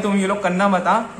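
ordinary talk

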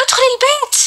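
mild acted shriek; retold screamed order. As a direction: imitate a screamed command with pitch far up. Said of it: "صارت تصرخ علي وتقول لي".

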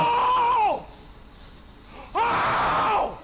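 loud high yelps; shouting in pain. Real, not acted. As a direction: yell out in real pain. Getting hit in fight.

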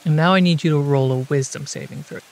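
ordinary delivery

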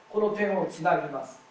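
normal talking voice

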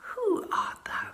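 high, hushed whisper full of awe; a giant whispering in awe; awed whisper. Acted. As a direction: breathe it out in wonder. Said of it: "a strange awe fell upon him".

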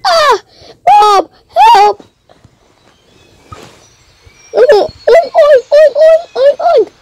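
very high, squealing oinks; a panicked piglet squealing. Pretend. Kid screams, pig squeals.

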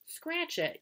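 tossed-off shout, high; high mock yell. A narrator's reading. Avery to his sister from the swing, a high teasing yell. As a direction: yell it back while swinging past. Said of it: "yelled Avery".

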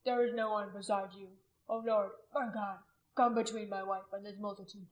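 anguished, penitent plea; weeping prayer. A narrator's reading. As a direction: confess to God through tears. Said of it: "He wept".

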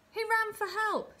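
very high, loud call from the crowd; excited onlooker calling out; eager shout. A narrator's reading. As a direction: shout out a hopeful guess, voice pitched right up. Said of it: "shouted someone".

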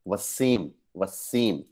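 Wasim repeated with the stress on सीम; Wasim said slowly with a falling tune on सीम; second-syllable stress. Shown as a demonstration. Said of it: "second place पे stress करते हैं".

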